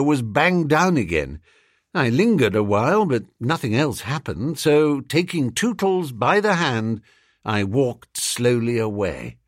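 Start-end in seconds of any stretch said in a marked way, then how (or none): none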